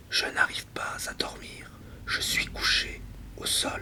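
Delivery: very soft, voiceless night-time whisper. Acted. Sleepless speaker lying on the floor, whispered.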